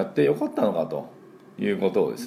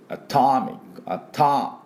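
アトミック with a higher, punched second syllable ター; stress on ター; 5 semitones higher, slower than usual. Shown as a demonstration. Teacher shows where atomic is stressed, stress on ター. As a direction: hit the ター in アトミック, weak first ア. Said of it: "ここを強くね。".

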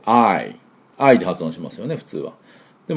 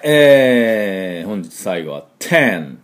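ordinary talk, nothing marked

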